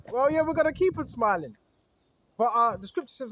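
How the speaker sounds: usual speaking voice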